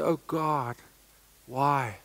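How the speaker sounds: soft, hushed, wondering prayer; humbled prayer. Retold or imitated. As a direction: quietly ask why me. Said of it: "Every day I say".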